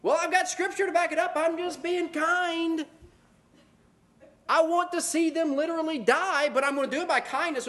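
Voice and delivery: high, smug mock self-justifying voice; mocking smug believer. Preacher mocks a vengeful believer with high smug whine. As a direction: ham up a self-righteous hypocrite. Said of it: "people will use the verse like".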